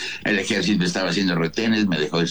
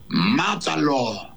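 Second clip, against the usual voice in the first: retold order; 7 semitones higher, much slower than usual. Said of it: "le dice el que me tiene apuntando".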